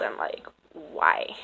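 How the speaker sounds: a baffled whispered why. Retold or imitated. Reading a creepy older man's message, she whispers her baffled question. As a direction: drop to a whisper in disbelief.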